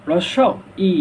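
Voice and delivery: "রস্ব ই" with a gap before "ই"; correct pronunciation. Shown as a demonstration. Teacher answers his own question, carefully separated "রস্ব ই". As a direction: pronounce "রস্ব" and "ই" as two careful units. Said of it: "সঠিক উচ্চারণ হবে কি ভাইয়া?".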